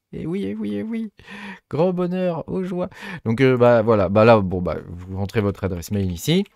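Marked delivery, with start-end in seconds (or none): 0.1-2.9 very high put-on voice of fake delight; mock rejoicing. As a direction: ironically celebrate your own blunder, pitched way up. Sarcastic joy over own mistake. (fausse joie ironique, voix très aiguë)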